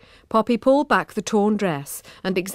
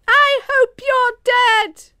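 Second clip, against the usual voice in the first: high, loud, slow furious shout; 8 dB louder, much slower than usual. A narrator's reading. Poppy shouting after Emma in a slow, loud, high rage. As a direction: scream every word after her. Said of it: "shouted Poppy".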